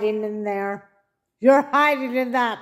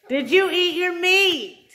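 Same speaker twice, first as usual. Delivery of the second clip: playful scolding schoolteacher, pitched higher; mock stern teacher; 7 semitones higher than usual. Wife teasing husband over meat and pudding, mock stern teacher. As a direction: bark it like a strict teacher, half laughing.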